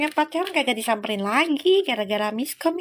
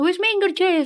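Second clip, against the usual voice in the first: mock angry Javanese; 8 semitones higher, 4 dB louder than usual. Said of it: "sampai marah-marah katanya".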